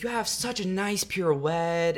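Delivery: put-on high, drawn-out old-lady compliment; imitated old lady admiring a dancer; old woman. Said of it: "she's like".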